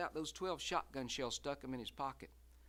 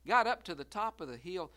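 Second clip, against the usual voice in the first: ordinary delivery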